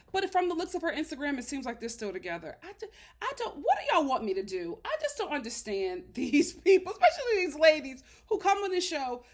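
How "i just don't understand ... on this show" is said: can't believe these people, laughing; laughing in disbelief. Real, not acted. Exasperated at the ladies on the show, laughing in disbelief. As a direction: laugh through the words, higher and louder.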